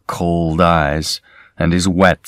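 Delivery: ordinary delivery, nothing marked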